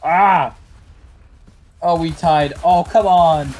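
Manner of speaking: loud, high groan of frustration; frustrated outburst. Real, not acted. Gamer tied the round, shouting in loud frustration. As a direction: shout it like you just missed the win.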